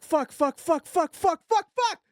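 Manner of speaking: losing it as it sprays everywhere; panicked swearing. Retold or imitated. Alone in the car as it sprays, a climbing panicked yell.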